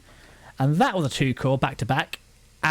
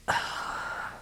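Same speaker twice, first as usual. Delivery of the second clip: a long, breathy sigh of frustration; fed-up sigh; exasperated sigh; 9 dB quieter than usual. Real, not acted. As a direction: let out a weary sigh. Fed up with the anime.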